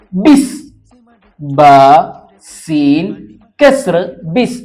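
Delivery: slow letter-by-letter hijja of ബിസ്; name each letter ബ, സീൻ, കെസ്ർ, then join them as ബിസ്; spelling out letters. Shown as a demonstration. Teacher spells the word for students, each letter slowly and clearly. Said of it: "ഇവിടെ നിങ്ങൾ ശ്രദ്ധിക്കുക".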